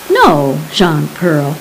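ordinary delivery

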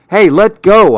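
high, loud yell to let go. A narrator's reading. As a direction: shout it, grabbing back the pocket. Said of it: "I yelled".

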